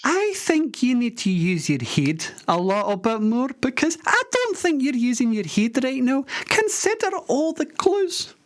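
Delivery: high-pitched comic Scottish radio host. Acted. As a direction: play a squeaky wee Scottish DJ.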